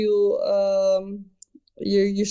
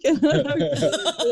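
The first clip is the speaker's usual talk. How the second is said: laughing; 6 semitones higher than usual. Real, not acted. Laughing at own story.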